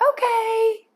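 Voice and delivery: sudden high, loud okay; bright release. Real, not acted. Trainer frees the puppy from her place with a high, loud okay. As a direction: pop the release word up high.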